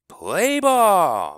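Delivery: bellowing umpire. A narrator's reading. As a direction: bellow it across the field. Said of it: "bellowed Doctor Dan".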